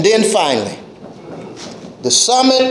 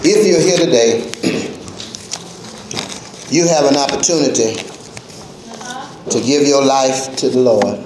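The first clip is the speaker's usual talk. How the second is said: altar call invitation; 13 semitones lower, 3 dB quieter than usual. Acted. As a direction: gently call people to the Lord. Invitation at sermon's end.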